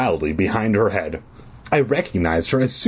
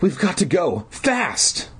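frightened urging; 9 semitones higher, slower than usual. Acted.

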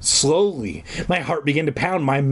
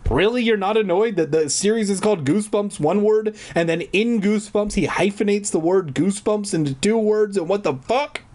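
higher, exasperated rant; baffled, indignant complaint; incredulous rant; 7 semitones higher than usual. Real, not acted. Ranting about hyphenated Goosebumps.

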